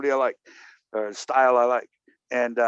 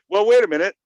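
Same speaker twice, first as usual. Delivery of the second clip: louder, higher wait; interrupting call; 7 semitones higher, 9 dB louder than usual. Retold or imitated. Interrupting the teacher at the retreat, louder and higher. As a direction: cut in on the speaker.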